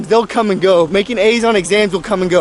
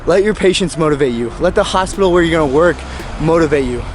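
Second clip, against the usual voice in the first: normal talking voice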